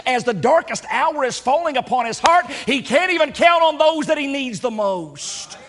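preacher lamenting the sleeping disciples; fervent preaching. Acted. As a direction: preach it with rising fire. Preacher rises to full force.

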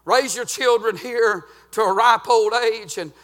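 rising holler of a revival preacher; fiery preaching. Acted. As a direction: preach it out full force, half chanting. Preaching at full force.

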